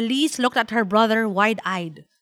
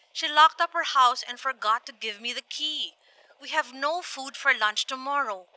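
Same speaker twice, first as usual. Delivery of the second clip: soft, regretful granny voice; quiet, apologetic grandmother; grandmother on phone; 2 semitones higher, 6 dB quieter than usual. Acted. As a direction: explain the mishap softly down the line. Grandmother explains bad news.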